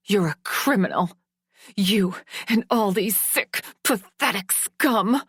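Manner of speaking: enraged daughter lashing out; furious accusations. Acted. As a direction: spit the insults at him. Daughter insults her father.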